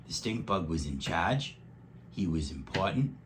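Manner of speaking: usual speaking voice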